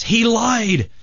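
high, hammed-up distressed protest; beaten man reporting. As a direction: deliver the punchline as an aggrieved cry. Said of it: "the man says".

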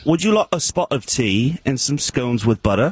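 imitation London English accent; London accent attempt. Co-host takes his turn for judge, London accent attempt. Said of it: "I was probably more London".

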